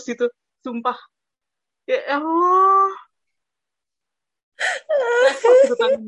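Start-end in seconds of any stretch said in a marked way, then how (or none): 1.9-6.0 mortified wail. Retold or imitated. Student despairing over the hand kissed, loud mortified wail. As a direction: drag it out into a comic, tearful wail.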